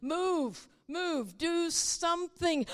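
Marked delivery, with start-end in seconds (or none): none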